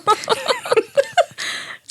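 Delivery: a squealing giggle that climbs very high. Real, not acted. Teasing her co-host over a gift, a high squealing giggle. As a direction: let a shrieking giggle rise up. Laughing after teasing compliment.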